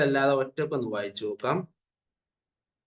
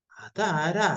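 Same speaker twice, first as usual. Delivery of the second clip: dragonfly asking; 5 semitones higher, much slower than usual. Shown as a demonstration. Teacher voices dragonfly asking.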